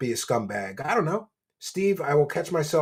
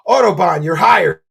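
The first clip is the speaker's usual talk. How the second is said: excited; 3 semitones higher, 12 dB louder than usual. Real, not acted. Excited thanks to donor.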